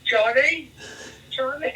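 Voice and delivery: high, meek, sing-out call; a would-be gangster gone timid and squeaky; best gangster voice, failed. Retold or imitated. Frightened inmate answering Bronson at night, comically high gangster attempt. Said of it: "in my best South London gangster voice".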